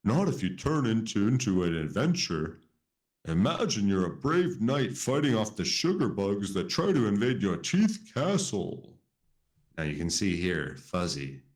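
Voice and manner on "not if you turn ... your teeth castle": a hint of a chuckling owl; lightly voiced owl. Ollie pitching brushing as an adventure, lightly voiced. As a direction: coax him playfully as the owl. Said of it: "Ollie chuckled".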